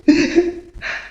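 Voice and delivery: sudden high-pitched mad giggle; shrill giggle. Acted. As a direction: giggle like a madman proud of his work. Gloating after dismembering corpse.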